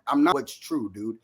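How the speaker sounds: usual speaking voice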